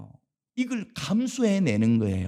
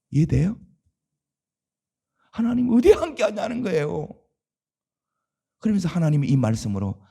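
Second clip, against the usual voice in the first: normal talking voice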